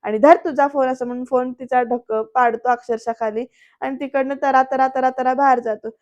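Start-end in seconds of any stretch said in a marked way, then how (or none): 0.2-0.9 snapping it out in anger, a little louder; angry. Retold or imitated. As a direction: shove the phone back at her, irritated. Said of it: "म्हणतो बरं का चिडून". (रागाने, थोडं मोठ्याने)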